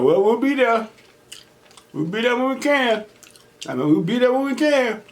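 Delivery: mom's voice. Retold or imitated. Mom answering on the phone.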